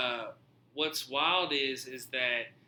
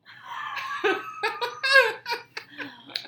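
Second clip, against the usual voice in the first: high, loud burst of laughing; loud laughter; 9 dB louder than usual. Real, not acted. Teller cracks up at his Duracell joke, high loud laugh. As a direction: crack up at your own joke.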